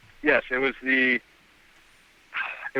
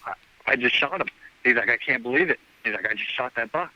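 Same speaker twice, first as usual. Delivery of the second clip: ordinary talk, nothing marked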